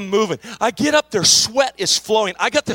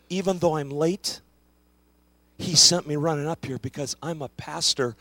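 gentle comfort; 4 semitones lower, 6 dB quieter than usual. Real, not acted. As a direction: speak low and tender to a hurting man. Pastor comforts crying clerk.

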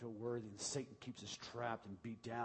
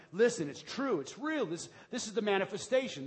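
higher, louder preacher insistence; emphatic burst; 7 semitones higher, 9 dB louder than usual. Real, not acted. Pastor pressing the point on his congregation. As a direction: drive it home with a burst.

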